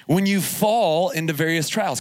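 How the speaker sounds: usual speaking voice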